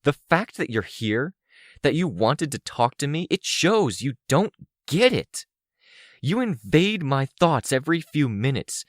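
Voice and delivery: high-pitched angry tirade, building; harsh, fervent anger. A narrator's reading. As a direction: let the accusations pour out with rising fervor. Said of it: "so I spoke with more fervor, more harshness in my voice".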